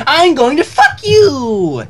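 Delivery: high, slow, gleeful shout; gleeful Pinkie Pie. Acted. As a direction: drag out the punchline with glee. Pinkie Pie joke line.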